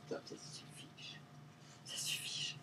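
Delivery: slow, voiceless warning; whispered scolding. Real, not acted. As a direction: tell them under your breath that it is enough. Telling her dogs to stop. (réprimande chuchotée)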